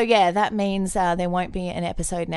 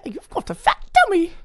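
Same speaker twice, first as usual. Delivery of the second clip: imitating nurse; 8 semitones higher, 4 dB louder than usual. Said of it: "that nurse said to you".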